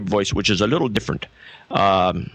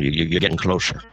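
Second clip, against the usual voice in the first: ordinary talk, nothing marked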